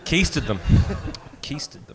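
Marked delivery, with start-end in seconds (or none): 1.4-1.9 quiet repeat. Real, not acted. Host repeats the customs joke, soft and breathy.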